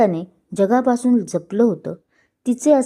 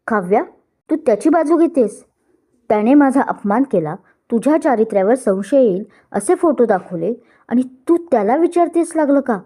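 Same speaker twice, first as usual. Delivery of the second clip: furious, much higher and raised; angry; 4 semitones higher than usual. Acted. Groom angry at bride for helping the rival, furious. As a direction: lash out in jealous rage. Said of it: "वीरला हे पाहून अजून राग आला".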